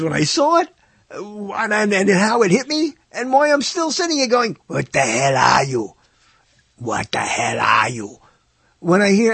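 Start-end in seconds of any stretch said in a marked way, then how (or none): 4.7-8.2 put-on slow, lower Austrian-accented Arnold; Arnold Schwarzenegger impression. Host doing his Arnold line from Predator, slow Austrian accent. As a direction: growl it out slowly like Arnold in Predator. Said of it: "I don't even do Arnold Schwarzenegger except for that".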